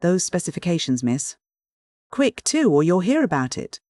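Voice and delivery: hint of a bossy office boy leaping to a squeak; lightly voiced cracking Cockney youth; breaking adolescent voice. A narrator's reading. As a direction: demand it importantly, pitch jumping up midway. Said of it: "began in a bass roar and ended in a treble squeak".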